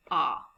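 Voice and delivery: the sound "aw" held alone; aw vowel sound. Shown as a demonstration. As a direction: say "aw" as in saw, open and round. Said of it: "make a special vowel sound".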